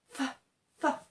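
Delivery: whispered fa, all breath. Shown as a demonstration. Teacher breathing out a whispered fa. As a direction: blow out a soft unvoiced fa. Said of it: "When you breathe out".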